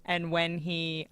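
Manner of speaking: usual speaking voice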